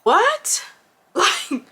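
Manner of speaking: high-pitched baffled "what"; incredulous. Retold or imitated. Woman reacting to her date's confession, incredulous. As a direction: shoot the pitch up in disbelief.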